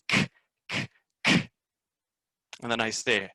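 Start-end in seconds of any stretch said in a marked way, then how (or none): 0.1-1.5 unvoiced "k" sounds, clipped and repeated; whispered k sound. Shown as a demonstration. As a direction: breathe out the "k" sound for kids. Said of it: "I go".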